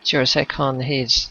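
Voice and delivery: normal talking voice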